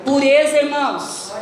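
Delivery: loud fervent preaching; full-force sermon. Acted. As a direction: hammer the point home from the pulpit.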